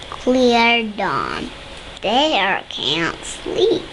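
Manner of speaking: usual speaking voice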